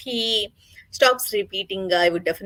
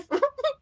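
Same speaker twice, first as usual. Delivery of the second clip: high laugh. Real, not acted. Laughs at own imitation.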